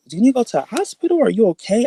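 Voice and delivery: high, rushed worried questions; freaking out. Retold or imitated. Mom asking about the hospital, freaking out. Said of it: "she's freaking out and she's like".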